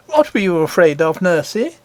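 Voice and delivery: put-on a touch of a young girl asking; little princess.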